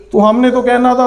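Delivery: normal talking voice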